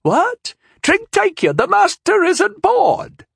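put-on very high, slow, cracked old woman's voice; a confused old woman snapping back; angry old woman. A narrator's reading. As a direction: scold them as a flustered old servant. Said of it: "she insisted angrily".

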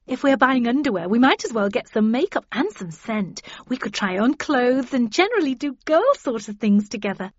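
excited, chattering pitch to a friend; eager gushing. A narrator's reading. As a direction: gush through a list of plans. Eager friend pitching a shopping trip.

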